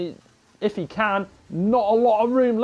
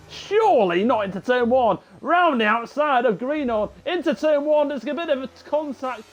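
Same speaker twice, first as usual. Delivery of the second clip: excited commentary; 6 semitones higher than usual. Real, not acted. Commentator watching a risky overtake.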